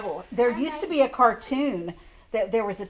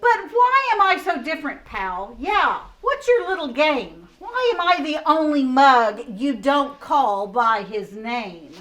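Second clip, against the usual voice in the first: high, loud complaining koala; indignant koala; 4 semitones higher, 8 dB louder than usual. Acted. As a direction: whine out the koala's grievance. Reading the koala's rhyme.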